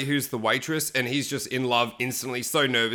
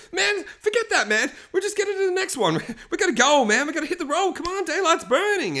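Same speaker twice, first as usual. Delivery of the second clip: high, strained mock panic; hammed-up high-pitched nervous urging; freaking out. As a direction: hurry everyone along in comic desperation. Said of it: "he's like freaking out".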